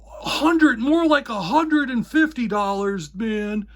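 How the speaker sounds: high, loud indignant outburst; furious retort. Retold or imitated. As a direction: snap back a price like an offended dealer. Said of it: "he just started getting, like, furious".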